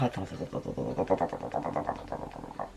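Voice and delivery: very low, soft repeated mumble; concentrated muttering. Real, not acted. Vlogger concentrating on her make-up, muttering to herself. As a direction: mutter it rapidly under your breath, deep down.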